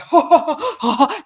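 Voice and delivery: high, loud cackling laugh of a madman; mimicked crazed laugh. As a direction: cackle like someone who's lost it. Imitating the delirious brothers.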